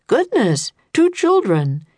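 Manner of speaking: high, startled goodness; a gentleman caught off guard; surprised man. A narrator's reading. Mister Perkins finds two children on the stairs, surprised. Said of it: "he said".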